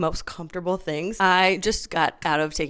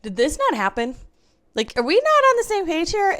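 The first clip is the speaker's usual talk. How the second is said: confused surprise; 13 semitones higher, 6 dB louder than usual. Retold or imitated. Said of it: "I was like".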